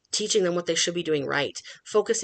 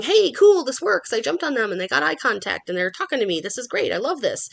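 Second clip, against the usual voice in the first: a high, louder excited dog voice that bursts out, then settles; voicing the dog; 6 semitones higher, 5 dB louder than usual. Trainer voicing a dog pleased with the attention, high and excited. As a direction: play the delighted dog who thinks jumping works. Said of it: "They're going".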